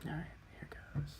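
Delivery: whispered self-encouragement. Real, not acted. Teller readying himself for the story, whispered. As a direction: whisper it to yourself before starting. Psyching self up before telling.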